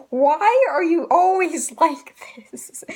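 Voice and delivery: retold yell breaking into a laugh; Nami's mock yell. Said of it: "when Nami yells".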